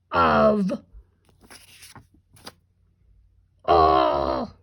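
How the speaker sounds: loud, drawn-out monster growl. Shown as a demonstration. Teacher reviewing sight words with kindergarteners, monster voice. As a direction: growl each word by itself, long and loud. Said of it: "use our monster voice to read them".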